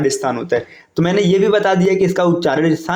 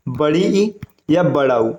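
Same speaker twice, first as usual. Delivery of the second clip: बड़ी ई या बड़ा उ drawn out slowly, each word weighed; slow emphatic key phrase; 3 semitones lower, slower than usual. Shown as a demonstration. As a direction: spell out बड़ी ई या बड़ा उ slowly as the trick to remember. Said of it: "ये इसका trick है". (बड़ी ई या बड़ा उ को धीरे-धीरे ज़ोर देकर बोलना)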